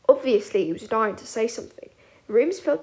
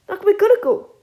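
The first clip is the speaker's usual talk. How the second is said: high, loud squeak; 10 semitones higher, 10 dB louder than usual. A narrator's reading. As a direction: squeak it out nervously. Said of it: "Peter squeaked".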